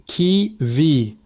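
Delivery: Kiwi split in two, "kee... vee"; separated syllables. Shown as a demonstration. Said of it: "just like how we say it".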